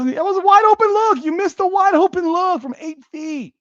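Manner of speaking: high, loud disbelief at the miss; furious fan outburst; exasperated yell. Real, not acted. Exasperated at missed shot.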